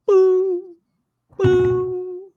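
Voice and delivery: very high, loud electronic "boo"; ping it out like a sonar blip; imitated blips. Game master showing how the tracker blips, high imitated beeps. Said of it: "like, it blips. It's like".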